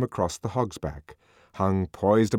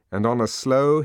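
plain reading voice

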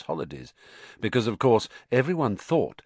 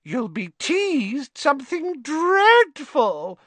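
put-on much higher, slow, gloating ghost girl; happy ghost; 4 dB louder, slower than usual. Myrtle taunts Hermione gleefully, high and slow. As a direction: savour every word of her misery. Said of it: "said Myrtle happily".

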